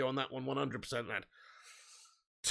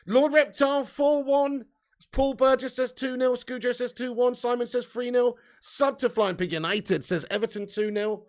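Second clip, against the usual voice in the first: ordinary talk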